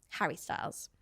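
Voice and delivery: normal talking voice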